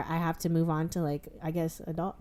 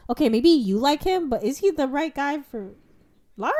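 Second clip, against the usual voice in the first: skeptical retort; 6 semitones higher than usual. Retold or imitated. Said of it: "I'm like".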